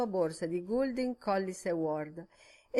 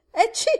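sudden high, very loud sneeze; explosive sneeze; 11 dB louder than usual. A narrator's reading. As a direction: sneeze hard mid-sentence. Second sneeze mid-sentence.

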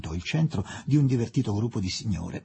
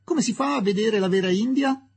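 higher, louder call across the room; 10 semitones higher, 3 dB louder than usual. Acted. Ronnie calls to Fielding in passing, raised cheerful shout. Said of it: "ed egli gridò a qualcuno che passava".

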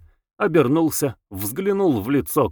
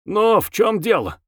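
high, sharp demand; officer's sharp, high-pitched challenge; sharp call; 9 semitones higher than usual. A narrator's reading. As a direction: call it out curtly, raised pitch. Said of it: "резко крикнул он".

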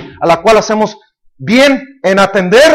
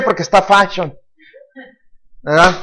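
ordinary talk

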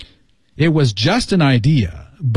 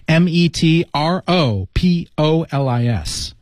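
each letter of METROPOLIS said separately; spelled out. Shown as a demonstration. Teacher spelling a new word for learners, letter by letter.

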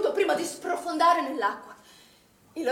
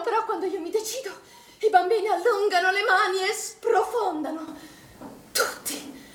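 voice breaking; 6 semitones higher than usual. Acted. Recounting nightmare, breaking down.

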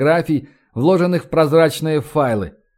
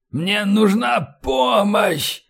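high, very slow, strained rasp; wounded man labouring for help; wheezing plea; 6 semitones higher, much slower than usual. A narrator's reading. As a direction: wheeze out each word in pain. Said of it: "сипел, надсадно кашляя после каждого слова".

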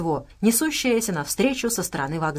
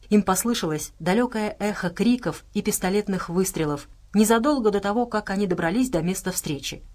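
plain reading voice

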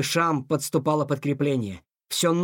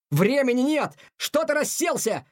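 high-pitched, impatient scolding; urgent cry; 9 semitones higher than usual. A narrator's reading. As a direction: cry out to get a friend moving. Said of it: "закричал Тихон". (срочный, высокий окрик)

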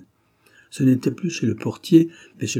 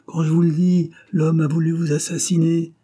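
high and loud, insisting hard; with conviction; 7 semitones higher, 4 dB louder than usual. A narrator's reading. Granoux insisting to Rougon, full conviction. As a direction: drive it home, sure of every word. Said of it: "répéta-t-il avec conviction".

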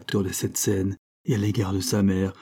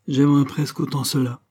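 ordinary delivery, nothing marked

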